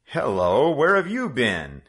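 a lightly voiced hearty father; father's greeting. A narrator's reading. The father greets his returning son.

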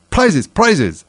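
high, loud clamour for prizes; confused calling out. A narrator's reading. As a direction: call out excitedly as a crowd. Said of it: "calling out in a confused way".

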